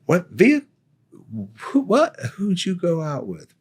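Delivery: high-pitched, sputtering disbelief; a stunned sergeant tripping over his own questions; incredulous boss. Retold or imitated. Sergeant major grilling him.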